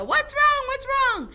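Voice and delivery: high, loud alarmed parent; worried parent. Retold or imitated. Parents running in, asking their child what's wrong. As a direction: cry out like a panicked parent. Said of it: "our parents come running and go".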